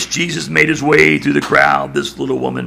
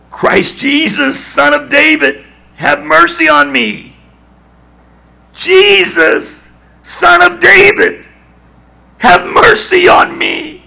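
slow, much higher desperate cry; louder, more determined; 7 dB louder, slower than usual. Acted. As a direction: call out with everything, drawn out. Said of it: "the louder and the more determined".

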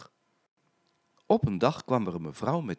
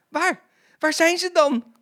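high, loud alarmed shouts; shouting; 9 dB louder than usual. A narrator's reading. Shop assistants hunting for the mice, shouting. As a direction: shout it in a flurry of panic. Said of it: "de andere winkeljuffrouwen schreeuwden".

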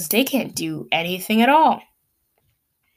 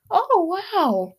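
mock amazement; 9 semitones higher than usual. Sarcastic reaction to shot count.

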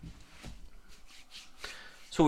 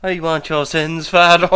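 mock confession voice; 3 semitones higher, 7 dB louder than usual. Voicing confession scene.

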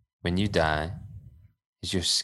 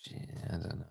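sarcastic; 15 dB quieter, much faster than usual. Real, not acted. Sarcastic aside.

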